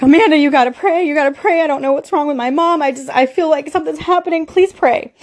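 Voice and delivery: high, urgent pleading; desperate begging without full yelling volume; retold panicked yelling. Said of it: "I'm screaming, I'm yelling".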